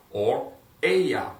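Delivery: slow "ella" with stress on the first syllable; model pronunciation. Shown as a demonstration. Teacher modeling "ella" slowly for the class. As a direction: stretch "ella" out for students. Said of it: "I want you to repeat after me".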